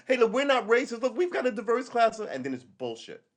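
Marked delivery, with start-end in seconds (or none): 0.1-2.3 high, mocking voice of a company boasting; mock the brand as a phony; high, sarcastic corporate boast. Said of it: "they're always pushing race".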